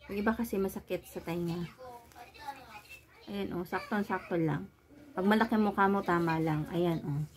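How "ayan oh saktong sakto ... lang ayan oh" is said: muffled behind mask; speaking under covered mouth; through a face mask. Real, not acted.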